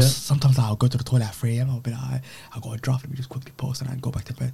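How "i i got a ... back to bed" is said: quoted softly, under the breath; soft-spoken self-quote. Retold or imitated. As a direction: say it small and soft, as a passing excuse. Recounting what he tells people at night.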